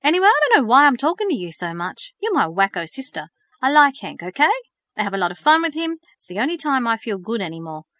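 loud, heated and pushy; angry outburst. A narrator's reading. Thomas defending his friend to his sister, angry. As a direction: fist on the table, lashing out. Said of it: "pounded the table with his fist".

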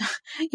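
short soft laugh; a quick amused laugh; light laugh. A narrator's reading. Verity laughing at her brother, light laugh. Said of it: "She laughed".

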